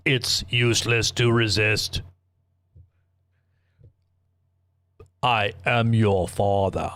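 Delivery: slow, lightly put-on villain voice; a slow, measured hint of a movie villain; Darth Vader. Telling the crowd what the villain said, in a slow villain voice. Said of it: "Darth Vader said that".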